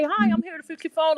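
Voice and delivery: a light, formal repairwoman hello; polite service voice. Retold or imitated. Repairwoman greeting customer at door, polite and formal. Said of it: "if I say".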